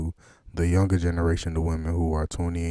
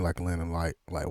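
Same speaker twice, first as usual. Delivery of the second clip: usual speaking voice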